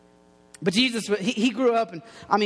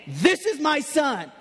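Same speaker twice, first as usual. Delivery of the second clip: slow, higher, proclaiming voice; God's voice; 5 semitones higher, slower than usual. Acted. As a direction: declare it grandly from heaven, drawn out. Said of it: "you hear God's voice saying".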